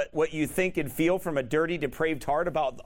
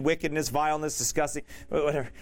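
ordinary talk

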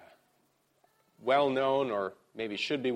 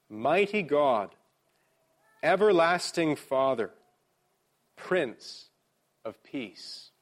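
slow, raised reading with long pauses; slow scripture reading; 5 semitones higher, 4 dB louder, slower than usual. Real, not acted. Pastor reads one verse so we can meditate on it, slowly. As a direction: weigh each phrase, pitched up and deliberate. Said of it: "I'm gonna read it slowly".

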